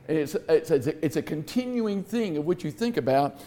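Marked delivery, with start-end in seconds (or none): none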